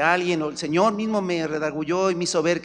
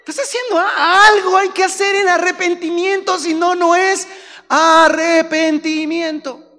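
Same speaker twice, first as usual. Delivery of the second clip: fiery preaching; 12 semitones higher, 8 dB louder, slower than usual. Acted. Preaching at full force.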